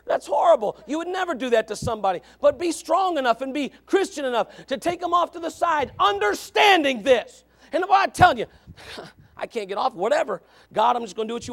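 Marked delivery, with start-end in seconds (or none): 2.4-8.5 raised, emphatic preacher's delivery; forceful preaching. Real, not acted. Preacher urging the church to correct others, forceful. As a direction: drive home each phrase, drawing out key words.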